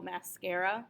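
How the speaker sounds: mascara said the English way, "mas-KAIR-uh"; English pronunciation. Shown as a demonstration. Teacher giving the English cognate as an example, English pronunciation of mascara. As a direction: say mascara with an English "air" vowel in the middle. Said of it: "the way that we do in English".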